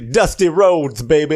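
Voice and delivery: high, loud lisping wrestler voice, slowed; Dusty Rhodes lisp. Retold or imitated. Mocking the wrestler's lisp to his cohost, loud. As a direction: drawl it out with a lisp. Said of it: "he's got that lisp".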